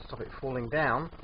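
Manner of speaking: usual speaking voice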